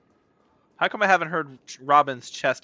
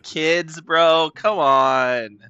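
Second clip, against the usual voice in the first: loud, higher-pitched gushing; gushing outburst; 6 semitones higher, 10 dB louder than usual. Real, not acted. Streamer gushing over Robin watching the kids, loud gushing. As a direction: cry out as if overcome.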